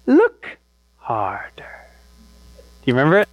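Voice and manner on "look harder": put-on slow, drawn-out whisper in a thick accent; Rafiki's thick accent, hushed and stretched out. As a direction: wise seer's thick accent, whispered slowly. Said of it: "He says it with that great thick accent".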